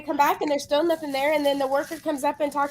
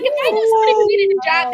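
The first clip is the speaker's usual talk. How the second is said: quick, raised indignant retort; fast loud exasperation; 7 semitones higher, 8 dB louder, much faster than usual. Retold or imitated. Answering the clerk, fast loud indignation. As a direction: fire it back incredulous. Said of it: "I'm like".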